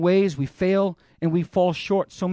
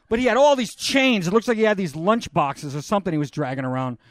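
ordinary delivery

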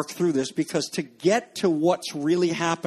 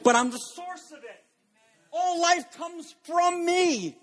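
voicing Jesus; 5 semitones higher than usual. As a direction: build to a soaring declaration of life. Jesus quoted, building to climax.

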